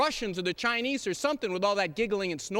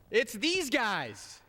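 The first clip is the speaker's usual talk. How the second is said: slow dramatic reveal; much slower than usual. Acted. Sarcastic floor speech, dramatic reveal.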